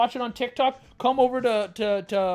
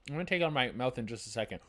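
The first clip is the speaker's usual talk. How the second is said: normal talking voice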